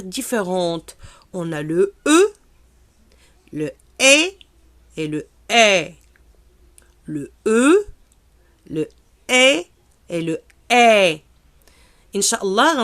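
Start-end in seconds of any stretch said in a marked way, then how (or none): 1.3-11.2 "le E" said three different ways, loud and clear; three E sounds. Shown as a demonstration. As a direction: contrast the three E sounds for learners. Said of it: "elle se prononce de trois façons différentes". (trois façons de prononcer le E)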